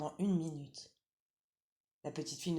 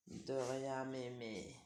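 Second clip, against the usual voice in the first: low, soft, slow sly wolf; wolf pretending to obey; wolf faking sweetness; 3 semitones lower, 7 dB quieter, much slower than usual. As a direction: purr it like a cunning wolf.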